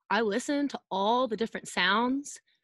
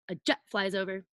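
ordinary delivery

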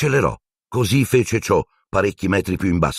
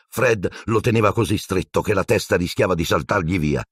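plain reading voice

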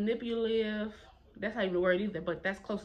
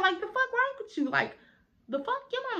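indignant disbelief; 13 semitones higher than usual. Retold or imitated. Outrage at teacher in fight.

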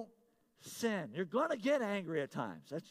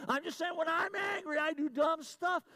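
high-pitched, raised-voice protest; emphatic insistence; 8 semitones higher than usual. Real, not acted. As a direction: blurt the confession out high and forceful. Insists again after congregation stays silent.

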